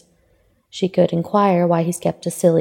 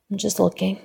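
quiet; 2 semitones higher, 5 dB quieter than usual. A narrator's reading. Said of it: "It's the quietest thing he said yet".

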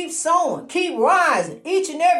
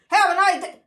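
a greeting heard as a sneer, pitched higher; misheard greeting; 5 semitones higher than usual. Retold or imitated. Quoting a greeting twisted by drink, mock snide tone. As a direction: make a friendly line sound like a dig. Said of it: "It ain't gonna sound right when they say it".

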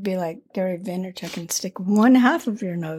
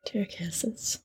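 whispering; 13 dB quieter than usual. Real, not acted. Joking correction during tasting.

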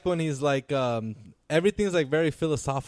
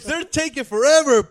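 mock cop voice; 12 semitones higher, 9 dB louder than usual. Friends acting out cops bursting in.

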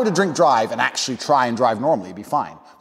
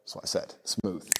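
ordinary delivery

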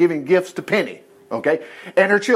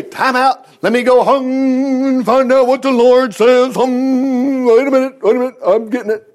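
lightly voiced, higher, unsure priest; mock waiting priest; 5 semitones higher, 4 dB louder than usual. Preacher mocking a priest stalling for an answer, hammed-up. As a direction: pretend to wait for a word from God. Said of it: "Notice he didn't say".

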